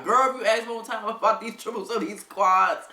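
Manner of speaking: high, loud mock exasperation; hammed-up fed-up scolding. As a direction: imitate someone tired of being pestered. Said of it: "they got tired of me".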